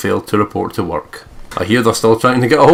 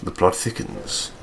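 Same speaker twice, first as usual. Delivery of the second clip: whispering; 8 dB quieter, slower than usual. Acted. Dramatic aside.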